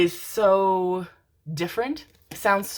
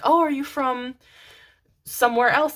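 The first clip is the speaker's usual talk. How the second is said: higher voice for a quoted asker; imitated curious question; 8 semitones higher, 5 dB louder than usual. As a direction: mimic someone asking, pitched up. Acts out people asking the question.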